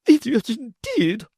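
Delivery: high, indignant lady's cry. A narrator's reading. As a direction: snap back in offended outrage. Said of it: "cried my lady".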